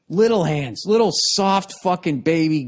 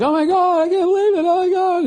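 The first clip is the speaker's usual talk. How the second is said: very high, louder mock-astonished squeal; crowd gasping in awe; 4 dB louder than usual. The crowd reacts to the presidents leaving, squealing high. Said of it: "And everybody's like".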